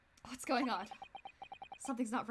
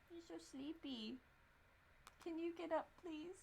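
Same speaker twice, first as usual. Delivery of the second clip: much softer, slow murmur to a sleepy pet; soft to cat; 4 semitones higher, 14 dB quieter, slower than usual. Real, not acted. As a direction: hush it to the dozing cat. Talking softly to sleepy cat.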